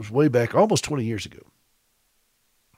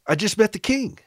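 high-pitched, thrilled announcement; excited; 9 semitones higher than usual. Retold or imitated. Visitor tells the pastor about meeting royalty, excited. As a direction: re-enact bursting with exciting news. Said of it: "I was very excited".

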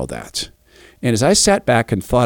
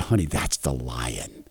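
soft fatherly aside; 11 semitones lower, 12 dB quieter, slower than usual. Retold or imitated. Dad pointing out lion softly.

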